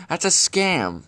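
high, loud accusation; outraged. Acted. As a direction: call it out indignantly. Ranting about paying for traps.

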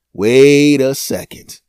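loud, slow eureka; loud realization. Acted. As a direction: call it out slow, struck by an idea.

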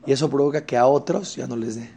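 ordinary talk

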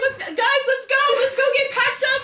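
high, eager call to the group; rally everyone in an excited high voice. Pretend. Player hurrying friends off on a mission, excited rallying.

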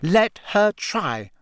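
high, slow, deliberate dare; defiant. A narrator's reading. Hermione answering Ron's warning, defiant. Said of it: "said Hermione defiantly".

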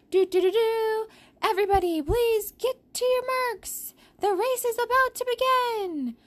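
high, bright announcer call; race announcer. Acted. Race announcer calls start.

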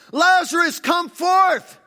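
high, full-volume commanding shout; loud calling out. Acted. Jesus summoning Lazarus from the grave, loud calling out. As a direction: call a dead man out of his tomb. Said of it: "he calls out in a loud voice".

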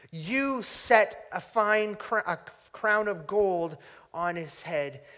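slow, solemn reading of a psalm, lifting key phrases; scripture reading. Acted. Preacher reads the psalm aloud to his congregation, solemn and measured. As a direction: read the psalm like a proclamation.

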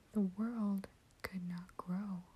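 ordinary delivery

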